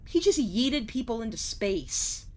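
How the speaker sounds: normal talking voice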